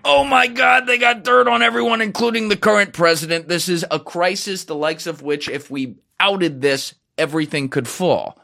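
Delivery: an agent losing it over the discovery, hammed-up panic; panicked outburst. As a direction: blurt it out in alarm, then calm toward the end. Analyst panicking, acted out.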